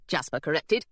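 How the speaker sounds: ordinary delivery, nothing marked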